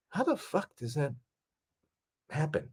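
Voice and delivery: high, hushed mock amazement; imitated stunned disbelief. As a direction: imitate an awestruck viewer, soft and halting. Imitating stunned viewers of footage.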